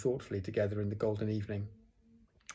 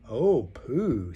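loud, swooping exclamation; admiring; 2 semitones higher, 6 dB louder than usual. A narrator's reading. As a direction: share a friend's wonder. Everyone admires Pooh's present.

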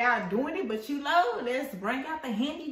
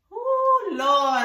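scared; 6 semitones higher, 9 dB louder than usual. Real, not acted. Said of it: "I'm so scared".

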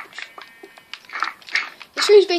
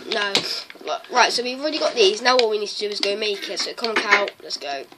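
usual speaking voice

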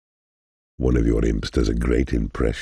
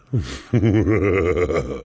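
high mocking chuckle; mocking laugh; 7 semitones higher than usual. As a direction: laugh at the player's expense. Mentor laughs at player.

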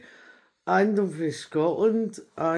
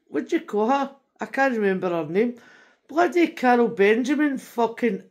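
normal talking voice

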